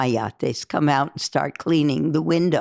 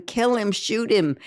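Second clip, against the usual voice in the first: higher, mild mock yell; half-hearted yelled command to shoot; retold yell; 6 semitones higher than usual. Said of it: "I yelled at Reuben".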